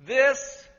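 This hit loud and high; stress on This. Real, not acted. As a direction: punch the word This. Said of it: "Not a generation two thousand years".

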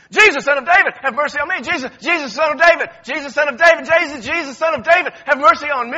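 loud, high, insistent repeated cries; repeated shouting. Acted. Beggar calling again and again to Jesus. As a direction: keep calling out over and over. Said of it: "he wasn't just saying this one time".

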